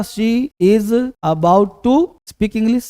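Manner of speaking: very slow, word by word for students; teacher slowly dictating the English sentence to his students; slow dictation. Shown as a demonstration. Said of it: "क्या बताएं बेटा".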